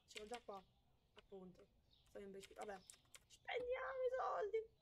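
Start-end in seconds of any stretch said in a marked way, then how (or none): 3.3-4.7 sudden high sarcastic shout; hammed-up shout of reckless glee; mock outcry. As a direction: fling it out like a mock battle cry. Sarcastic cry about spending money.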